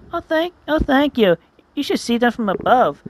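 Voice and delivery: high grateful voice. Acted. Flower thanking the hedgehogs, high and grateful. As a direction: gush thanks in a high little voice.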